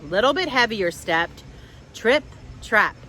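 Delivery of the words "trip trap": ordinary delivery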